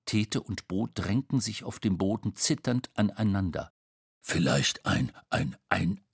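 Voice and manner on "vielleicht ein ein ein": low, halting guess; hesitant low-pitched stammering; fearful stutter. A narrator's reading. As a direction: stumble over the word, timidly. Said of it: "stotterte Tete".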